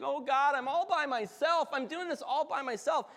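high, whiny mock lament; mock whining lament. Elijah whining to God about being alone, high mock lament. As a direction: whine it like a self-pitying prophet. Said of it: "he's, like, crying".

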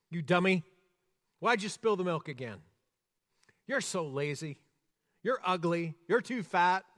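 loud, harsh mock scolding of a child; scolding parent. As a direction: bark it like a fed-up parent. Acting a harsh criticizing parent.

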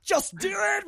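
high, loud mock movie yell; quoted yell. Quoting Robert Downey Junior's movie line, high loud yell. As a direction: belt it out like the film character.

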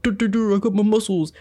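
dopey meathead voice; dumb gym bro. Retold or imitated. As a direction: play a brainless muscle bro. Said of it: "fucking bros there who are like".

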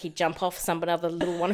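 ordinary talk, nothing marked

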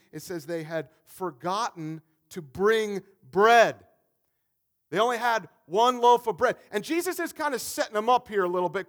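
loud, rising sermon delivery; preaching at full force. Acted. Pastor to his church, preaching loudly at full force. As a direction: drive the story home to the congregation.

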